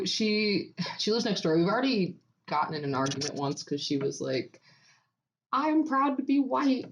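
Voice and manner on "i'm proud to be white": imitating neighbor. Quoting the neighbor.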